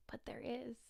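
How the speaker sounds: breathy secret whisper. Real, not acted. Teasing viewers about her news in a whisper. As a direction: let it slip in a hushed whisper.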